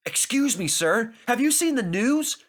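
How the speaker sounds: lightly voiced excited station master. A narrator's reading. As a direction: burst in with news.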